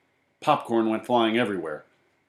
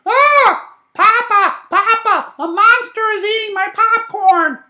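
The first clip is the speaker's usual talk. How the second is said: put-on very high, loud screaming little kid; child's scream; 13 dB louder than usual. A narrator's reading. Small child crying to Papa about a monster, screaming. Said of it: "The small child screamed".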